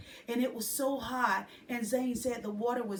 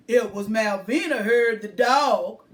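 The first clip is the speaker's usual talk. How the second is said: louder, rolling man's voice; rumbling musical voice; 2 semitones lower, 10 dB louder than usual. A narrator's reading. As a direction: rumble it out like a friendly old man. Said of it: "in a rumbling musical voice".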